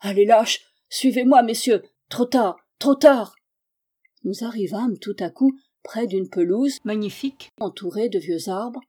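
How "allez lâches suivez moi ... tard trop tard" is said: loud, higher desperate calls; urgent shouting. A narrator's reading. Stranger rushing ahead to save the girl, shouting.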